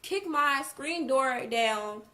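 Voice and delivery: loud, slow, high-pitched disbelief; incredulous retold outburst; shocked. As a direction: act out stunned disbelief, loudly and slowly. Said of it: "I'm in shock".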